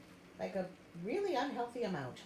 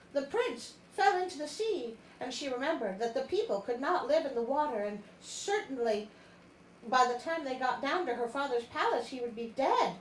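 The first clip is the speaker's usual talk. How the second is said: dramatic story reading; 3 semitones higher than usual. A narrator's reading. Reads story narration aloud.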